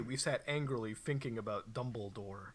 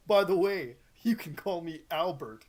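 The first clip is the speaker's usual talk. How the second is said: loud, higher call; 3 semitones higher, 6 dB louder than usual. Acted. Dumbledore calling after the goths as they leave. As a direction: call it after the students leaving. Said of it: "he called".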